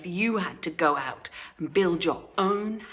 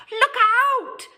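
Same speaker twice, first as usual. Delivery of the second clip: very high, louder piggy cry; alarmed cry; 7 dB louder than usual. Acted. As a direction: yell the warning. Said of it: "they shouted".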